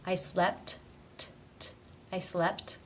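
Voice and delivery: SLEPT with its final T clearly released; actual T. Shown as a demonstration. Teacher modelling a cluster, released T ending SLEPT. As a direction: finish SLEPT on a crisp puff of T. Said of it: "pronounced as the actual T".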